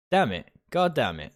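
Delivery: normal talking voice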